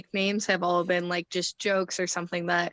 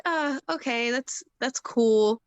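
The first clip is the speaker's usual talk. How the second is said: mildly hesitant, higher-pitched polite approval; humouring; 5 semitones higher than usual. Retold or imitated. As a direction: draw out an unsure, placating reply. Unimpressed trainer humours an odd answer.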